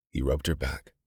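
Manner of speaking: usual speaking voice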